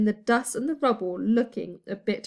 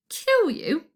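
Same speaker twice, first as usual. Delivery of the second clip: mock surprise; 8 semitones higher, 4 dB louder than usual. A narrator's reading. Pirate mocks the prince.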